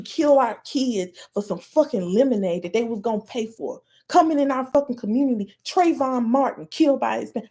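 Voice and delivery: ordinary talk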